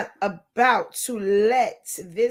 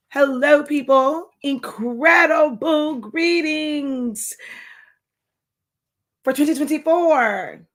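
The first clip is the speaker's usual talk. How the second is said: drawn-out greeting; 5 semitones higher, 4 dB louder, slower than usual. Acted. Slow announcer greeting.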